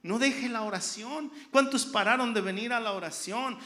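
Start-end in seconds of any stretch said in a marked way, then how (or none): none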